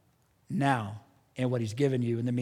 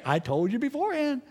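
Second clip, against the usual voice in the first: mock told-you-so; 9 semitones higher than usual. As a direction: play it like Tim gloating. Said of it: "Tim can come to you and say".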